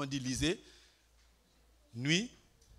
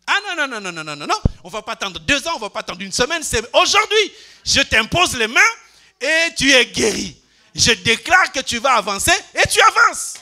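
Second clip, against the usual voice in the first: loud, higher declaration of healing; full-force preaching; 7 semitones higher, 11 dB louder than usual. Acted. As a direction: proclaim healing today with rising force. (déclaration de guérison à pleine voix)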